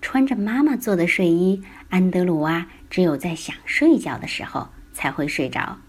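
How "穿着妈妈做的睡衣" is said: ordinary delivery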